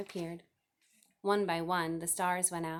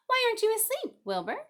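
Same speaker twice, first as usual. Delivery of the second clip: high, loud, fussy goose voice, repeating herself; a busy mother goose clucking the time; chattering goose; 7 dB louder than usual. A narrator's reading.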